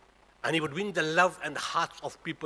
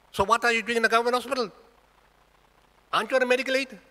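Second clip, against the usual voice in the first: high, louder questioning of an uncle; retold challenge; 9 semitones higher, 6 dB louder, faster than usual. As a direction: press him with pointed questions.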